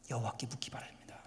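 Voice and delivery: soft whispered appeal. Real, not acted. Preacher whispering an appeal to the congregation. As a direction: whisper the plea gently.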